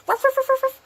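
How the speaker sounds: high imitated yaps; dog barking. Shown as a demonstration. Teacher imitating a dog, high barking. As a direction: bark in a high pitch like a dog. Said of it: "when we see a dog, like".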